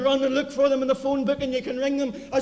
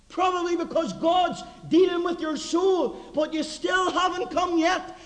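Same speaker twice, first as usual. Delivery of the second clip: fiery preaching; 6 semitones higher than usual. Acted. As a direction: press the warning on the congregation. Preaching at full force.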